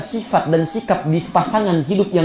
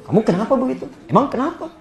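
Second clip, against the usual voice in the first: higher-pitched, mock-testy husband; irritated retort; 7 semitones higher, faster than usual. Husband answering his sulking wife, irritated retort. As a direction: act out a touchy, challenging comeback. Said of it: "Anda misalnya sewot".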